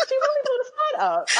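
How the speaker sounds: high-pitched squeal of mock dismay. Speaker replaying being put on the spot, high mock wail.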